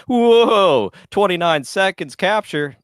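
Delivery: sudden high loud whoa; surprised whoa. Acted. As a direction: exclaim in surprise. Mock surprise at fast capture.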